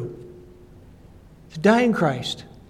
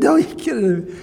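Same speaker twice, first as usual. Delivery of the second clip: laughing through the words, higher pitched; 7 semitones higher, 4 dB louder than usual. Real, not acted. Preacher retelling his reply to a dying friend, laughing. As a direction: chuckle it out in disbelief.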